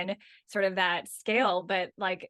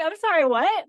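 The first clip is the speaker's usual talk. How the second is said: high, louder startled disbelief; stunned; 8 semitones higher, 7 dB louder than usual. Retold or imitated. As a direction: blurt it out in shock. Mom stunned by toddler's words.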